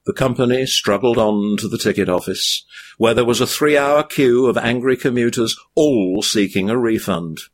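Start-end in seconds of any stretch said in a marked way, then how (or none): none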